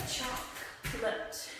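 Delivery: Chocolate whispered slowly in two syllables, choc-late. Shown as a demonstration. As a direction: breathe Chocolate as two quick beats. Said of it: "it's only two syllables".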